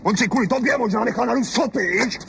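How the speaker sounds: high, heated husband's yell; the husband snapping at his wife over the neighbour; angry outburst. Acted. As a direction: lose your temper at her. Husband retorts.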